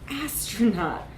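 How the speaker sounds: usual speaking voice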